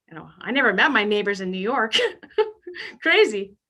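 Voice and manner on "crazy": usual speaking voice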